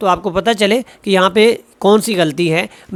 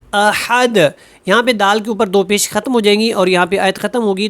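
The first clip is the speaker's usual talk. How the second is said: ordinary talk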